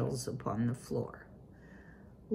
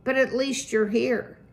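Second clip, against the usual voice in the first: raised, higher voice calling across the room; cried out; 6 semitones higher, 5 dB louder than usual. A narrator's reading. Father greeting late Poppy before the family, calling out. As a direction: call out to his late daughter. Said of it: "he cried".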